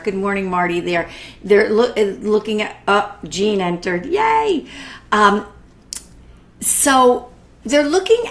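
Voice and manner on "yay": high little cheer of delight; happy cheer. Real, not acted. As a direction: cheer as a friend arrives. Cheering a viewer who joined.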